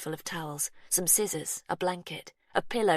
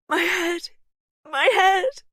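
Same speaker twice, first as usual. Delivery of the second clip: high, loud wail; cry of pain; 14 semitones higher, 11 dB louder, slower than usual. A narrator's reading. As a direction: cry out in agony. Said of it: "she cried".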